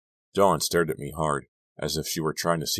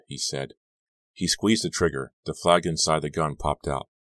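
ordinary delivery, nothing marked

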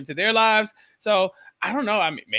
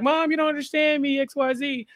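put-on whiny teen; 8 semitones higher than usual. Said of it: "Davy being like".

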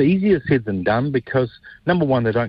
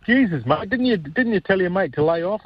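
mock exasperated outburst; 11 semitones higher than usual. Retold exasperated outburst at Leith.

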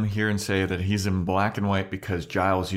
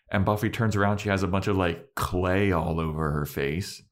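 ordinary talk, nothing marked

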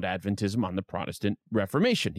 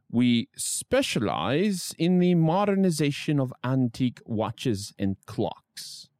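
reading the ad in a put-on British accent; light mock British accent; slower than usual. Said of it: "I don't know why I did a British accent there".

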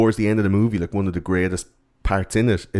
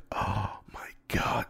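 slow, breathless whisper; awed whisper; 11 dB quieter, slower than usual. Retold or imitated. Bullies after Horace shoots the Gill Man, slow awed whisper. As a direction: whisper it in stunned awe.

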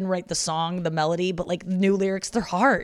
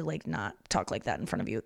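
hushed mom quote; 8 semitones lower, 8 dB quieter than usual. Retold or imitated. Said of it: "she was like".

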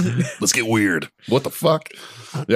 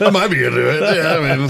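laughing; 6 semitones higher, 4 dB louder than usual. Real, not acted. Joking about being grabbed.

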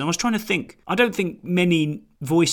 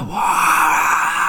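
crowd gasp; 2 semitones higher, 5 dB louder than usual. Retold or imitated. Imitating crowd reaction.

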